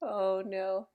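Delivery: ordinary delivery, nothing marked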